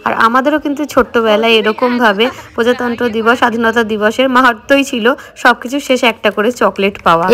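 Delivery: ordinary delivery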